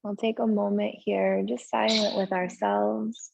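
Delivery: unhurried yoga relaxation voice with long pauses; slow-paced, settled breathing guidance; guided meditation. Real, not acted. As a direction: ease them gently into stillness, leaving space between phrases. Leads restorative pose and breathing.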